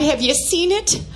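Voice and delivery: usual speaking voice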